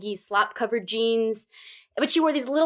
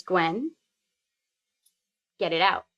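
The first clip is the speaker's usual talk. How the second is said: low, unhurried boss voice; very calm; 6 semitones lower than usual. Retold or imitated. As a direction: settle her with flat calm. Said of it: "Very calmly, Michaela says".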